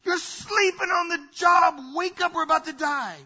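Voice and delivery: loud, high panicked cry for help; desperate shout waking a sleeping friend. Retold or imitated. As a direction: cry out like men about to drown. Disciples panicking in the storm.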